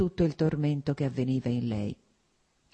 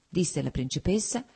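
usual speaking voice

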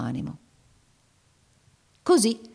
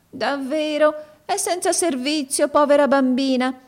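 much higher, put-on pitying old woman's voice; compassionate exclamation; 10 semitones higher, 5 dB louder than usual. Old woman feigns pity for the jobless girl, high and gushing. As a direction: gush with mock pity, high and loud at the end. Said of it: "esclamò con aria compassionevole".

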